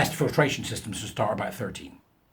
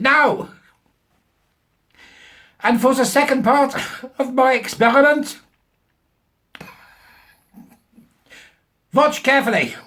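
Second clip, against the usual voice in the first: mock German professor; 13 semitones higher, 9 dB louder than usual. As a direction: ham up a self-important German scientist. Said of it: "of German extraction".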